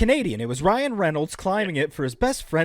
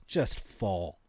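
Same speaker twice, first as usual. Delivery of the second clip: put-on French accent; 3 semitones lower, slower than usual. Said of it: "The French hate".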